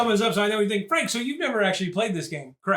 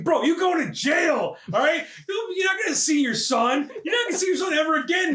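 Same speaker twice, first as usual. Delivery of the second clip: mock scolding shout; 9 semitones higher than usual. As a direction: yell the hard truth at him. Telling the arrested man off.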